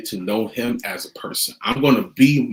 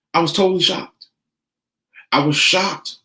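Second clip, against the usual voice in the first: retold shock; 5 semitones higher than usual.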